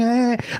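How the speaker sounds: high mock violin whine; imitated violin screech. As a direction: imitate a silly orchestral violin. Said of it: "like, violin going".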